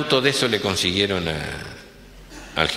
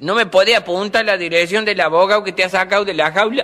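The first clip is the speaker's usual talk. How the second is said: higher, louder parrot mocking her; cheeky bird delivers the punchline; 11 semitones higher, 5 dB louder than usual. Parrot teasing the old woman, higher and louder.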